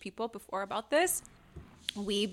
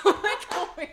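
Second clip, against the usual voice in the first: high-pitched words through real laughter; laughing; 8 semitones higher, 4 dB louder than usual. Real, not acted. Laughing at her own story.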